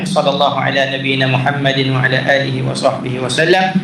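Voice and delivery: quick, low recited Arabic closing prayer; closing supplication. Acted. Teacher closing the lesson with the ritual supplication. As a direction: rattle off the closing duas softly.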